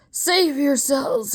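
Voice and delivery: high, slow croaked warning; croaking warning. Acted. Noosed professor croaks a warning to the crowd. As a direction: force it out through a tight throat. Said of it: "he croaked".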